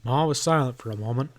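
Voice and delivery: ordinary delivery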